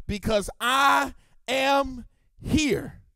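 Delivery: slow proclaimed promise. Acted. Preacher voicing Jesus to the congregation, slow and strong. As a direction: stretch each word out, strong and slow.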